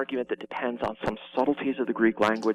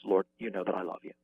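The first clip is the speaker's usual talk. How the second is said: softer, lower, humbled reply; 5 semitones lower, 5 dB quieter, faster than usual. Retold or imitated. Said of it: "He's been humbled".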